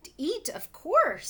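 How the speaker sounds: greedy creature delighting in eating dolls; gleeful. Acted. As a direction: savour it, smacking your lips. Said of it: "smacking his lips".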